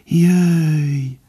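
high, long-held gentle call; fine birdlike call. A narrator's reading. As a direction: call like birdsong, stretching the one word. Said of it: "Finoman hangzott fel újra, mint a madár csicsergés".

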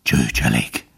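low, breath-heavy disdain; panting contempt. A narrator's reading. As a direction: gasp the word with boundless scorn. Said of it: "zihálta a róka mérhetetlen megvetéssel". (zihálva, mérhetetlen megvetéssel)

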